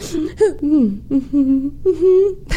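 put-on high whimpering child's sniffles; imitated sniffling. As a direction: mimic a child winding down from crying. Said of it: "started sniffling".

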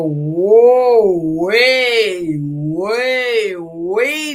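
WAY stretched long and loud in swooping waves; drawn-out emphasis. Real, not acted. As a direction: draw out each WAY, swelling loud. Stretching words for vast depth.

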